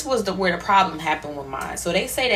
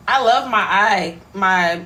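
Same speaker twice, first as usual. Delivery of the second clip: ordinary talk, nothing marked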